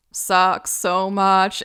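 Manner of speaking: slow, loud, dragged-out complaint; drawn-out groan. Retold or imitated. Getting towed back after the crash, a slow, loud lament. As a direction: stretch each word out in misery.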